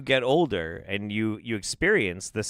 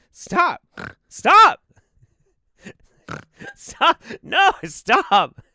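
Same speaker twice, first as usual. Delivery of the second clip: high, loud pleading through laughter; laughing stop; 8 dB louder, much slower than usual. Real, not acted. Cohost keeps snorting, begging him to stop laughing. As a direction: beg them to stop while cracking up. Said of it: "laughing pleading".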